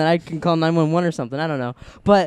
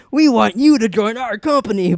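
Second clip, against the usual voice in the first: hammed-up ad announcer, higher; commercial voice; 6 semitones higher, 6 dB louder than usual. Joking that the biker sounds like an ad, mock announcer. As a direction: sell it like a recruiting commercial. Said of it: "I felt like I was like a commercial".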